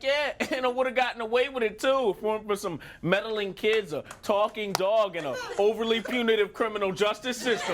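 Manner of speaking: high, aggrieved villain complaint; hammed-up cartoon villain; cartoon villain ranting. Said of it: "Not a single Scooby-Doo villain".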